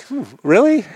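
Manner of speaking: high, doubtful "Hmm, really?"; mock surprise. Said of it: "And I'm like".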